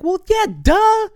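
high, loud mocking "duh"; sarcastic duh. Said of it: "it's like".

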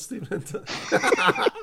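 teasing through a laugh, then a high loud burst of laughter; laughing out loud at his own joke. Real, not acted. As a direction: tease him and crack up.

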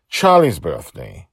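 ordinary delivery, nothing marked

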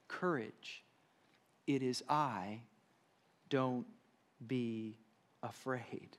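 soft, slow, spaced-out comfort; gentle reassurance. Acted. Jesus calming his frightened disciples, soft and slow. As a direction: let each word settle gently.

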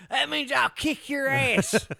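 put-on belligerent drunk. Playing redneck, laughing.

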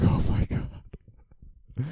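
breathy, drawn-out mock dismay; retold tearing up. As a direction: let it out as an airy, choked-up gasp, imitating yourself. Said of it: "tearing up".

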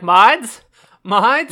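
exaggerated plea, voice jumping up; mock call for moderators. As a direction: imitate someone calling out for the mods. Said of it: "then be like".

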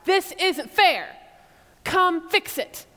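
high, slow, loud pleading complaint; high aggrieved plea to God; people complaining to God. Retold or imitated. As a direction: whine it up at God like an injured party. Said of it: "So they say to God".

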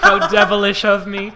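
loud, high, giggly chatterbox; hammed-up naughty delight. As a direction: exclaim it with mock wickedness, laughing. Acted chatterbox, called a reenactment.

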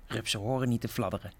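quiet, quick hiss of a scornful snake; lightly voiced snake, hissed fast and soft. Snake hisses at the caterpillar, soft and quick. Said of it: "siste de slang".

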